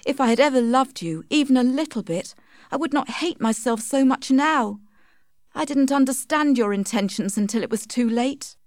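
high-pitched heroine full of regret; regretful Tess. Acted. Tess tells Alec she never loved him, regretful. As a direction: pitch it up in bitter self-reproach.

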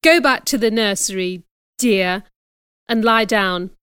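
ordinary delivery

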